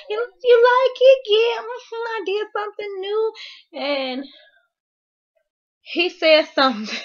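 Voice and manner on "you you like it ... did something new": delighted side chick. Retold or imitated.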